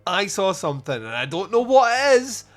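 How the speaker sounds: loud, rising mock-desperate plea; impassioned insistence. Witness tells detectives what he saw, hammed-up impassioned insistence. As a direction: act out a witness building to a high, loud pitch. Witness pleads passionately to be believed.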